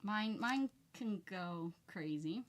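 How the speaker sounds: usual speaking voice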